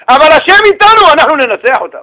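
high, loud cry of faith; rallying cry. Acted. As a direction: rouse the people with faith. Caleb's rallying cry quoted.

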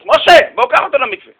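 loud, high call to someone outside; calling someone over. Retold or imitated. Man hailing a young man outside to take a guest along, called out loudly. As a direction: imitate hailing a helper from across the yard. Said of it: "קורא לאיזה בחור מבחוץ".

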